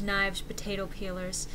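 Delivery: ordinary delivery, nothing marked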